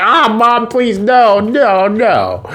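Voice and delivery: high, wailing plea to mom; hammed-up begging, pitched high; mock pleading. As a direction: plead in a put-on wail. Boy begs mom to stop.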